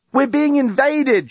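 slow, high-pitched shout; urgent shout. A narrator's reading. Journalist to the bomb over the phone, urgent shout. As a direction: shout it out word by word. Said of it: "shouted the journalist".

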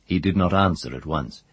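plain reading voice

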